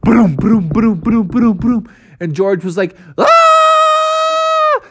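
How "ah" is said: very high, loud cartoon scream; monkey scream. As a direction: shriek as the rhino charges. George screams at charging rhino.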